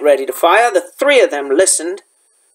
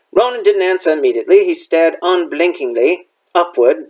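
ordinary delivery

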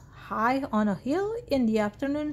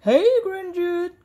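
high, loud friendly hail; cowboy's shouted greeting; calling out; 6 semitones higher, 6 dB louder than usual. A narrator's reading. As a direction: call out to someone across the distance. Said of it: "called the cowboy".

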